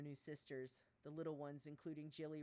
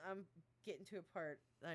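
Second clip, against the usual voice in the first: normal talking voice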